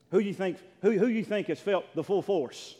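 loud, high preaching burst; full-force preaching. Acted. Pastor to his church, loud preaching. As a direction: preach it out to the congregation.

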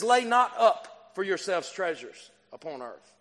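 solemn scripture reading. Acted.

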